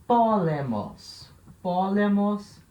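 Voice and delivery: slow, emphatic model word; drawn-out vocabulary demonstration; slow dictation. Shown as a demonstration. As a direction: stretch out each syllable for the class. Teacher models a Greek vocabulary word.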